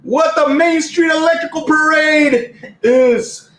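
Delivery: high, slow, shouted catchphrase; hammed-up promo boom; The Rock. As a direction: stretch it like a wrestler working the arena. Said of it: "I've used The Rock".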